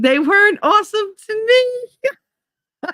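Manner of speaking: high, drawn-out comic whine; mock wail. Answering a fan of Carolina Reapers, mock wailing. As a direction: wail it like a wounded victim, hammed up.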